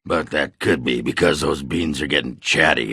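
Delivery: ordinary delivery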